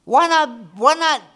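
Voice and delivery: mock eager voice. Still mimicking the eager prayer.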